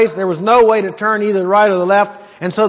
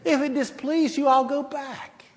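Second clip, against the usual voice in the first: high, soft, dopey mocking voice; thick-headed mock; 6 semitones higher, 9 dB quieter than usual. Preacher mocking Balaam's foolish answer, high and soft. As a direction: mimic a dim, meek reply. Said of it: "how thick-headed a person gets".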